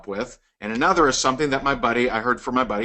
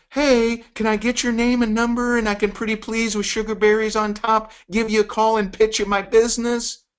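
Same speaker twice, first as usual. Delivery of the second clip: high, begging salesman voice; needy pleading; 7 semitones higher than usual. Retold or imitated. Mocking a needy prospector asking for a number, pleading. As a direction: beg sweetly like a desperate seller. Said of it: "that's how it sounds".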